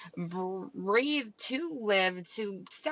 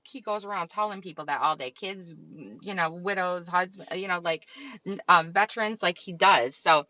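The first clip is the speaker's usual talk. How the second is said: usual speaking voice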